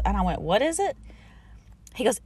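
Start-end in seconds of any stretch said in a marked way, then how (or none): 0.4-1.0 "What is it" pitched high; quick high-pitched "What is it"; high questioning retort. Retold or imitated. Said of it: "thinking, I meant".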